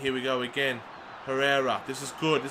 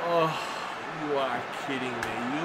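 low, much softer muttered disbelief; stunned disbelief; 6 semitones lower, 8 dB quieter than usual. Real, not acted. Player conceding early in the final, muttering in quiet disbelief. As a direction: say it under your breath, gutted.

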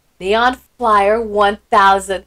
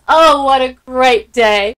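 usual speaking voice

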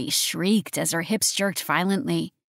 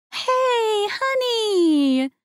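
high, bright motherly greeting; cheerful mother; much slower than usual. A narrator's reading. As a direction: greet her daughter happily. Mother greeting her daughter.

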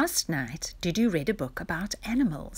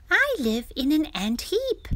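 high little insect voice; tiny ant; 10 semitones higher, 4 dB louder than usual. A narrator's reading. Tiny ant telling Dudu about home, in a tiny high voice. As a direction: pitch it right up, as a tiny ant. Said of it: "whispered the tiny ant".

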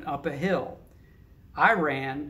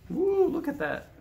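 excited exclaim; 12 semitones higher than usual. Real, not acted. Reader points at picture.

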